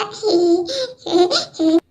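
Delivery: short low giggle of mockery; mocking giggle. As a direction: laugh at her friend's failure. Mocking laugh at friend.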